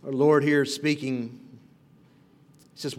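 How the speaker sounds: usual speaking voice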